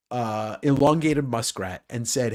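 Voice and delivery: normal talking voice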